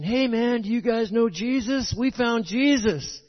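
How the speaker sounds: high, loud, eager hippie voice; hippie hitchhiker. Retold or imitated. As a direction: hype it like a stoked hippie. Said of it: "they're saying".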